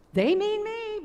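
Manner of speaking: high, joyful disbelief; a sudden lift of joy; rejoicing. A narrator's reading. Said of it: "Boncha rejoiced".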